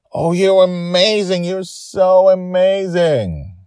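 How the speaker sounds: high, loud, slow gushing praise. A narrator's reading. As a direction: pour out delighted praise. Grandma gushing praise while hugging.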